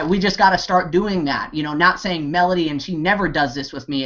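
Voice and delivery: usual speaking voice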